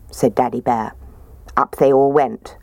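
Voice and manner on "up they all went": ordinary delivery, nothing marked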